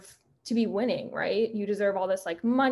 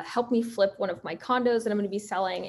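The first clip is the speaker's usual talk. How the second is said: normal talking voice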